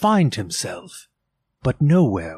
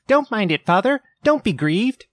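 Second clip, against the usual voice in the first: much higher, louder little voices; children's voices; 11 semitones higher, 4 dB louder than usual. A narrator's reading. Children to grieving Bob, high gentle pleading. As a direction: comfort father as small children.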